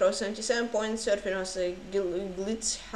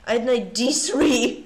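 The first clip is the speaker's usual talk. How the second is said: ordinary talk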